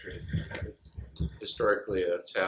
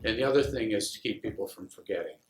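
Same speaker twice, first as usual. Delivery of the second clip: normal talking voice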